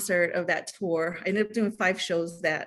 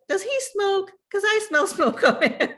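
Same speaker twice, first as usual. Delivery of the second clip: put-on higher, childlike questioning voice; little daughter's voice; 12 semitones higher, 4 dB louder than usual. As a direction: play her small curious girl. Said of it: "one of my little ones is like".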